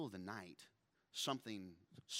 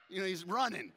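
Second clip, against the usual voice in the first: ordinary talk, nothing marked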